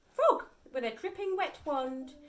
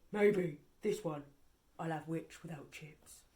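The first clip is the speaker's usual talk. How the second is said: lower, softer sinister dragon; 9 semitones lower, 6 dB quieter than usual. A narrator's reading. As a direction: savour the thought of dinner. Dragon gloats over witch.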